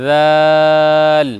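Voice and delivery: জাল held very long and slow; drawn-out letter. Shown as a demonstration. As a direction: stretch জাল out for pupils to copy. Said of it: "আমার সঙ্গে বলতে থাকুন". (ধীরে টেনে জাল উচ্চারণ)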